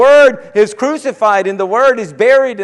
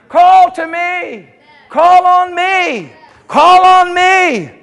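slow, loud and high, drawn out as God calling; pleading call; 9 semitones higher, 6 dB louder, much slower than usual. Acted. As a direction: stretch each call as an urgent invitation from God. Preacher pleads on God's behalf.

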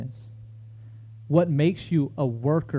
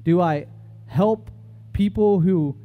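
preaching at full force; 7 semitones higher than usual. Acted. Preacher testifying to his congregation, fervent and soaring. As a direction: lift the sermon to its peak.

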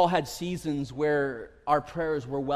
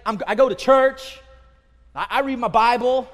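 indignant protest; 8 semitones higher, 10 dB louder than usual. Retold or imitated. Indignant believer voiced.

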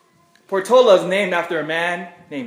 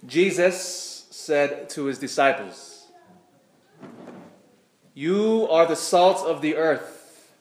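scripture reading. Acted. Reads Gospel aloud at Mass.

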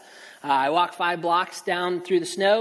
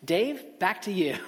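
laughing; 2 semitones higher, slower than usual. Retold or imitated. A laughing reporter handing the broadcast back to Dave. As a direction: try to sign off while cracking up.

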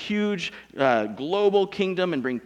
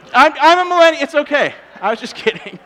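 high, loud laughing backpedal; quickly laughing it off after a millennials joke; laughing apology; 11 semitones higher, 11 dB louder than usual. Real, not acted. Preacher laughs off his millennials joke, loud and high. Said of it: "Did you hear that pause".

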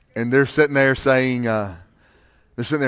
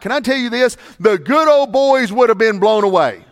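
ordinary talk

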